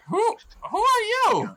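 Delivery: very high, stammered goblin challenge; startled goblin. Acted. Goblin answering a voice from the bushes, high startled voice. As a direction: squeal the question out like a confused goblin. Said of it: "they hear that and they're like".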